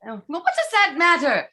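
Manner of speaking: ordinary talk, nothing marked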